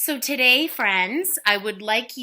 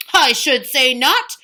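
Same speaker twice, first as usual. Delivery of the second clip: blustering; 6 semitones higher, 8 dB louder than usual. Acted. Giant boasting.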